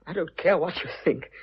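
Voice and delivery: usual speaking voice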